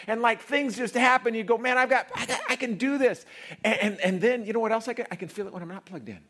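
high, loud, driving preacher; full-force preaching. Acted. Preacher to congregation, loud and high. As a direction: pound out God's love to the crowd.

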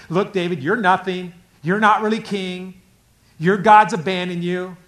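loud, high, hammering taunt; loud accusation. Acted. Enemy telling David he is nothing, loud accusation. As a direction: hammer each charge at full preaching volume.

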